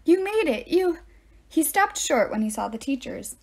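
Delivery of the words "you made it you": high, eager, out-of-breath greeting; breathless relief. A narrator's reading. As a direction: blurt it out after a run. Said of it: "Grover ran up breathless".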